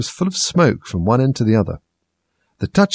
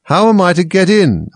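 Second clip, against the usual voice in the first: higher, louder insistent question; 9 semitones higher, 6 dB louder than usual. A narrator's reading. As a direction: demand it, raising the voice. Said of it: "in a louder tone".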